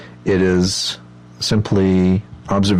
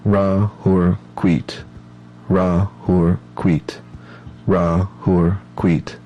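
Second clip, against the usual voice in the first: slow, even chant with a pause after each syllable; measured meditative mantra, evenly spaced; slow rhythmic mantra; much slower than usual. Shown as a demonstration. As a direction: intone it steadily, one beat per syllable. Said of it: "it might sound like this".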